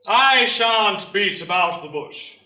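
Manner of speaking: slow, booming public address; parade-ground orator opening his speech; recruiting sergeant major. Acted. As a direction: address the whole square, drawing the words out.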